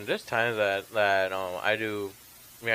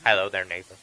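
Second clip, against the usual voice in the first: Mister Rogers; 3 semitones lower, 4 dB louder than usual. Retold or imitated. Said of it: "I did, like, a Mister Rogers".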